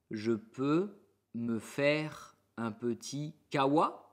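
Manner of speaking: slow, pausing after "Je peux"; slow chunked. Shown as a demonstration. Teacher slows the question so students can repeat. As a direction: split the question in two.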